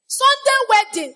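high, loud announcement; hammed-up exhortation; excited shout. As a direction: burst out with joy. Preacher excited about quick wedding.

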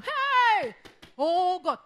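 very high, loud hammed-up wail; mock panic. Sufferer wailing about wind in the room, mock panic. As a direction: cry out in exaggerated distress.

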